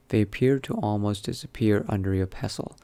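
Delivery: normal talking voice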